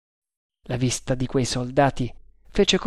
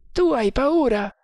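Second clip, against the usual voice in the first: a higher voiced guard, confident; overheard guard; 11 semitones higher than usual. A narrator's reading. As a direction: play the first guard, sure of himself. Guard teasing. (guardia sicura di sé, voce più alta)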